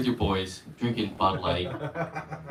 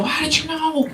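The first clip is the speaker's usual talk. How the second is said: much higher, astonished; mock amazement; 13 semitones higher than usual. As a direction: gasp it like a dupe. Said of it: "I go".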